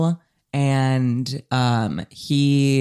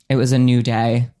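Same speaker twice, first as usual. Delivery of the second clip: ordinary delivery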